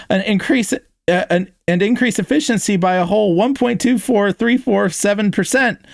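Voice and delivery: high-pitched excited techie gushing; eager, high character voice brimming with enthusiasm; enthusiastic. A narrator's reading. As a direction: rattle off the details with glee, voice way up. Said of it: "Pepper added enthusiastically".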